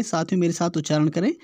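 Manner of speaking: usual speaking voice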